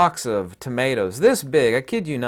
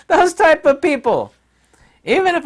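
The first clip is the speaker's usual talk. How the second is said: much higher and louder through laughter; laughing; 8 dB louder than usual. Real, not acted. Mocking hippie shoppers after cracking up, laughing squeal.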